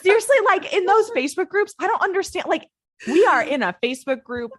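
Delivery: high-pitched, half-laughing exasperation; exasperated rant. Real, not acted. Cohost venting about acronyms in Facebook groups, exasperated rant. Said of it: "my anger about that phrase".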